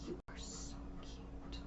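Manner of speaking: soft whispered praise. Real, not acted. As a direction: breathe it to the pup. Whisper to dog.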